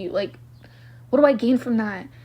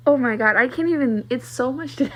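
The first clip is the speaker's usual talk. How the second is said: ordinary talk, nothing marked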